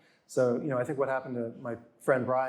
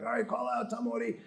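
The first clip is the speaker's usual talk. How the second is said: imitated crew call; 9 semitones higher, 3 dB quieter than usual. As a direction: mimic a TV crew calling out the star. Crew calling out the star.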